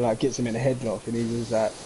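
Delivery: ordinary delivery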